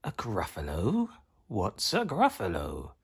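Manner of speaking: soft, hushed snake voice; puzzled snake. A narrator's reading. Snake asks mouse about the monster, softly and slyly. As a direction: ask it softly in a snaky voice.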